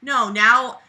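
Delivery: normal talking voice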